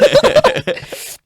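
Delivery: high squealing laugh; high burst of laughter. Real, not acted. Laughing at what his teenage self would say, a high squeal. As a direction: let out a high cackling laugh.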